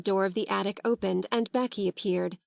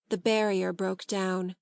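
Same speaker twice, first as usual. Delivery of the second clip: usual speaking voice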